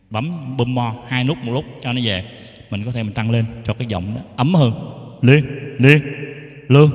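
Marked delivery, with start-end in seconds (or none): 5.2-6.9 loud, punchy test syllables; mic-check call. Shown as a demonstration. Teacher demonstrating a warmer mic tone, loud mic-check call.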